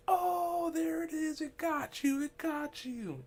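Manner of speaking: very high, loud mock squeal; high-pitched mimicry. Friend teasing the stoned storyteller, in a loud squeaky falsetto.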